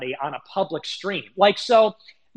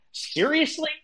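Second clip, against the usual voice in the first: incredulous; 10 semitones higher than usual. Real, not acted. Streamer scoffing at critics' excuse, incredulous high pitch. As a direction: pitch jumps way up in disbelief.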